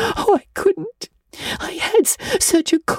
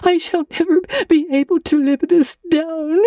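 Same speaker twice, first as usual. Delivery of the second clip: put-on high, tearful girl's lament with stammered catches; sobbing; 11 semitones higher than usual. A narrator's reading. Anne pours out her disgrace to Marilla, sobbing. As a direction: wail woefully, breaking up on words. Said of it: "sobbed Anne, without looking up".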